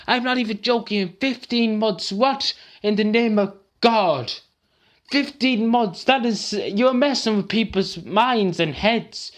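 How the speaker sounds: high, strained furious ranting; really pissed off. Real, not acted. Angry man ranting about mental health waiting lists, high and strained. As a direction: vent raw anger at the government. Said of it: "I'm really just pissed".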